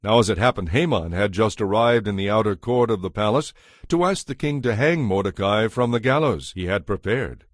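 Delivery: normal talking voice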